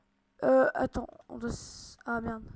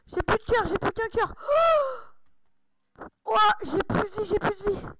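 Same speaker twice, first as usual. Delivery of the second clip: higher pitched, urgent panic; panicked; 4 semitones higher than usual. Real, not acted. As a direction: panic as your health runs out. Nearly dead in the game.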